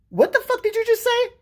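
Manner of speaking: high, loud, incredulous outburst; shocked disbelief. Acted. Brother stunned by little sister's crude confession, shocked high squawk. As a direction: blurt it out in high-pitched disbelief. His shocked reaction in the retelling.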